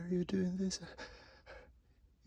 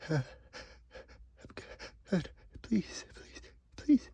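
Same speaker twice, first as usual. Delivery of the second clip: pre-sneeze gasps; slower than usual. Acted. Gasping build-up to sneeze.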